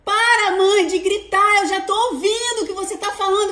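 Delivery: high, loud exasperated shout; annoyed teen yell. Retold or imitated. Daughter yelling at mother to stop shouting, exasperated. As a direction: snap back like an irritated teenager. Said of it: "ela fala".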